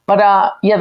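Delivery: ordinary delivery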